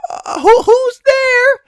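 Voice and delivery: very high, loud squeaky startled cry; frightened person at the desk. Acted. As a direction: shriek it like a scared clerk. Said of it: "the desk goes".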